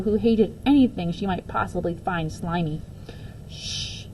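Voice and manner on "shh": soft unvoiced shush; whispered shush. A narrator's reading. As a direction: hush them gently. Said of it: "still laughing".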